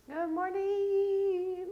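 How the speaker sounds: high, long-held "morning". Real, not acted. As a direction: stretch out a bright morning hello way up high. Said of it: "I can't raise my voice that much".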